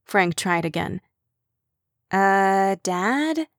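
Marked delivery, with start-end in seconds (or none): 2.1-3.5 rising "Dad?" in disbelief; questioning daughter. Acted. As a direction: skeptical teen voice. Chloe questions father.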